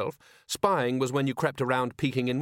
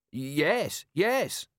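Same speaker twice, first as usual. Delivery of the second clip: high, over-eager confirmation; flustered agreement; 5 semitones higher than usual. A narrator's reading. Merchant clutches at the commander's suggestion, over-eager. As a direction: agree twice, pitch jumping higher.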